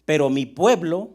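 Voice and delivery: loud, deliberate scripture reading; lectura bíblica solemne. Acted. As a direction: proclaim the psalm to the congregation, loudly. (lectura del salmo, fuerte y pausada)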